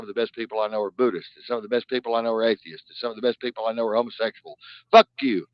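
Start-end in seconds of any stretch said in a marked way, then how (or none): 4.9-5.4 loud, higher fuck you; curt shouted curse; defiant curse. Real, not acted. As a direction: hurl it at the critics. Angry rant at critics.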